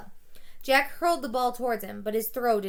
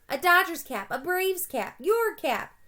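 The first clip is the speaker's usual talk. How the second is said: put-on begging kids; 7 semitones higher, 4 dB louder than usual. A narrator's reading. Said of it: "The begging soon turned into heckling".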